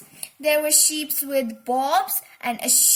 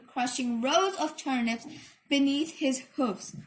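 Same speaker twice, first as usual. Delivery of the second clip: ordinary delivery, nothing marked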